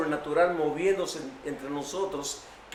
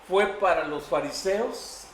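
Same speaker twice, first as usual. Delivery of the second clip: usual speaking voice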